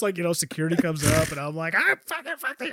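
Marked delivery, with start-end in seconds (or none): 1.7-2.7 high, strangled mock ranting; sputtering retold outburst, high-pitched; mock freak-out. Retold outburst as security arrives.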